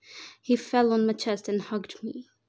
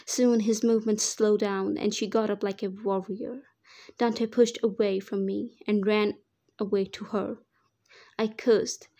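ordinary delivery, nothing marked

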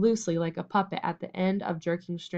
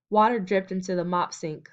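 usual speaking voice

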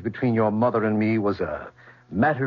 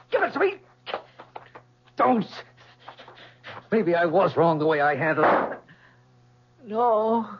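high panicked begging for life; plead, voice climbing in fear; terrified pleading; 9 semitones higher than usual. Acted. Pleading with an armed attacker.

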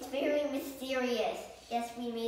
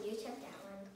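ordinary delivery

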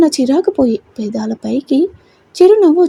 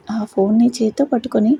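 ordinary delivery

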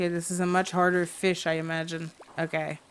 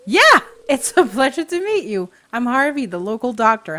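higher, louder put-on voice; friendly doctor introducing himself; 5 semitones higher, 8 dB louder than usual. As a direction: play an eager local doctor greeting someone. Voices game character Harvey.

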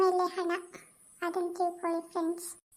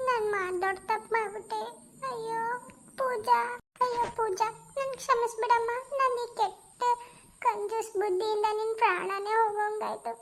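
high, pleading, on the edge of tears; tearful remorse; 3 semitones higher than usual. Acted. Mother-in-law begs Pooja's forgiveness, voice high and tearful. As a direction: beg forgiveness through rising tears.

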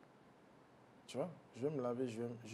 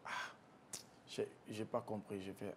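usual speaking voice

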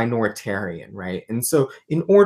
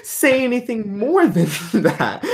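higher-pitched, said through laughter; laughing; 10 semitones higher, 3 dB louder than usual. Real, not acted. Guest laughing with the host over what the authors leave out, laughing. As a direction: break into laughter while making the point.

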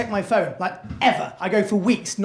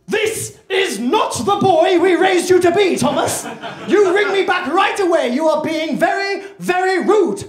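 high, loud mum's telling-off; hammed-up furious shouting mother; acted anger; 10 semitones higher, 5 dB louder than usual. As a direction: scold at full volume, stretching each word. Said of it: "The second one is anger".

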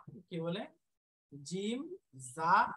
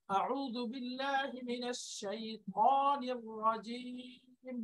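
Quran recitation; 3 semitones higher, 4 dB quieter, much slower than usual. Real, not acted. Quoting Quran recitation in lesson.

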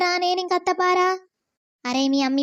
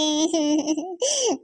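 sob. Acted. Daughter sobbing over father.

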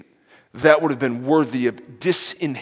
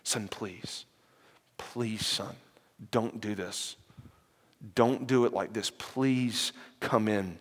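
much softer begging, slowing right down at the end; father begging his son quietly to come in; pleading father; 3 semitones lower, 9 dB quieter, slower than usual. Retold or imitated. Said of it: "was pleading and pleading".

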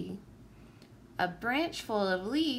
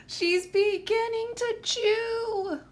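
high, slow, panicked character voice; panicked cry; 9 semitones higher, 3 dB louder than usual. A narrator's reading. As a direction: stretch out the panic. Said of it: "I cried out".

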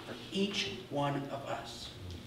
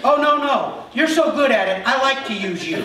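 high, loud teasing God voice; playful voice of God; God replying; 6 semitones higher, 5 dB louder than usual. Retold or imitated. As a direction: tease him back cheerfully. Voicing God's cheerful reply.

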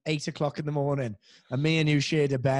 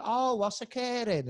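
high, drawn-out Barry catchphrase; Barry accent; 9 semitones higher, slower than usual. Retold or imitated. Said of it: "and then you've got Barry".

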